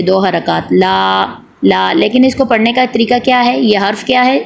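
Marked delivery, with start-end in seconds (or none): 0.7-1.9 ला held for just two beats; ला, a modest long aa, cut off without a closing m; short madd. Shown as a demonstration. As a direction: give ला a brief, measured lengthening. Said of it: "एक अलिफ की मिकदार लंबा करते".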